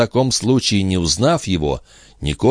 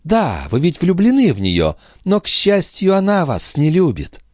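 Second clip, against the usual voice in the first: high-pitched taunt; acted quarrelsome jab; 11 semitones higher than usual. A narrator's reading. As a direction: throw it at a rival, voice pitched high.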